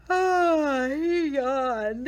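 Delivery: big loud yawn, voice stretched high; a loud open yawn. A narrator's reading.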